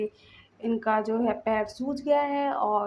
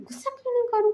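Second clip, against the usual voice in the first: sharp, much higher angry cry; angry retort; 13 semitones higher, faster than usual. Acted. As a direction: fire it back indignantly. (गुस्से में ऊँची आवाज़)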